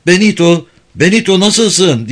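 high, loud calling out; shouting. Acted. Fred calling to injured Benito on the floor, loud shout. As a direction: yell his name in alarm. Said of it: "diye bağırdı Fred".

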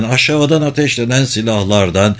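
plain reading voice

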